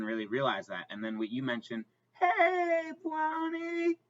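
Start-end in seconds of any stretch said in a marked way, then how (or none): 2.2-4.0 high cooing pet voice; talking to a dog. Real, not acted. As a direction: coo at the dog, high and sweet. Calling the dog by name.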